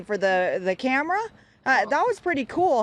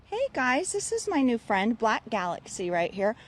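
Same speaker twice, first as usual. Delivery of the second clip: ordinary delivery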